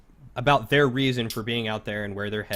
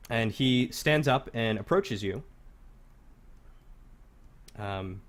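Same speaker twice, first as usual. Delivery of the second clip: ordinary delivery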